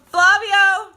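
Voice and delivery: shouted name. Real, not acted. Calling out a viewer's name.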